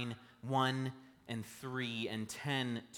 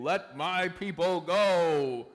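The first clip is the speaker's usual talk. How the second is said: slow, louder epic movie-Moses boom; Charlton Heston; 4 semitones higher, 9 dB louder, much slower than usual. Retold or imitated. Pastor mimicking the old Moses film, slow booming proclamation. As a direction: proclaim it like a Hollywood prophet. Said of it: "Charlton Heston putting down his staff and saying".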